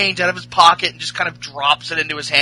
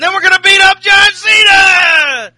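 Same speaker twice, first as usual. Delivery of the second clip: screaming hype, much higher and louder; hype yell; 13 semitones higher, 10 dB louder than usual. Acted. Host yelling a promo about John Cena, crazed hype shout. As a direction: roar it like a wrestling promo. Hyped wrestling trash talk.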